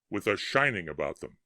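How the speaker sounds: plain reading voice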